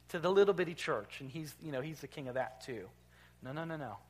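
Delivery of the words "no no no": ordinary delivery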